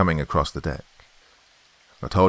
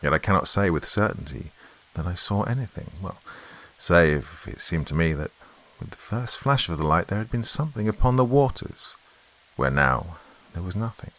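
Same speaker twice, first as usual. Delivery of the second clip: ordinary delivery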